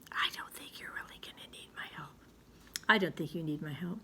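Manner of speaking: soft secretive whisper to the class. Real, not acted. Teacher confides to class.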